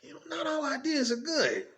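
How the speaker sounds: put-on high-pitched little kid protesting to dad; questioning child. Small son doubting his dad, in a high childlike voice. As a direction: push the pitch up into a child's objection. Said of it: "I remember asking him".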